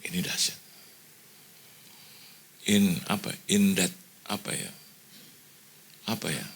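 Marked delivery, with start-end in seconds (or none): 0.0-0.6 hushed, amazed "Ini dahsyat"; awed whisper. Retold or imitated. Pastor whispering to himself, awed by the paid bill. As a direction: breathe it out in awe. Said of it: "Saya bilang".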